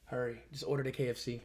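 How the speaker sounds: low, very soft insistence; quiet pleading. Real, not acted. Hungry streamer needs KFC ordered now, quiet pleading. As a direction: press them urgently but keep it down. Begging someone to order food.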